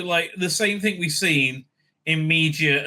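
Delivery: usual speaking voice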